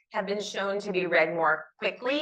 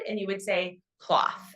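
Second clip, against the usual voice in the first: ordinary talk, nothing marked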